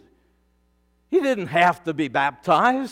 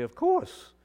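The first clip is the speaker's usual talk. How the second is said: Peter's quiet reply; 10 semitones lower, 9 dB quieter than usual. Pretend. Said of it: "And Peter would probably say".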